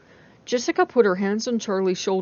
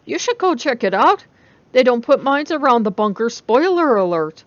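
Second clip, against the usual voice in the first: calling after her; 4 semitones higher, 6 dB louder than usual. A narrator's reading. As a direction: shout it after a stranger walking away. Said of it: "called out half-hurriedly".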